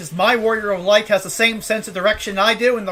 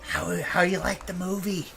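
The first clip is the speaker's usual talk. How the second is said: much softer, hushed mimicry; mock whisper; 4 semitones lower, 9 dB quieter than usual. Mocking the asshole whispering during the good part, soft. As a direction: murmur it like a pest in the cinema. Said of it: "some asshole going".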